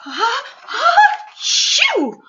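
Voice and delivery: high, loud building sneeze; big bear sneeze. Acted. Pepper tickling the bear's nose, a huge loud sneeze. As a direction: wind up and explode the sneeze.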